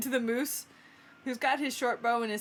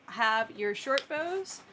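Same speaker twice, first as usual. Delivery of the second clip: usual speaking voice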